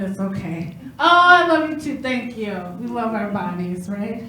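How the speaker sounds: ordinary delivery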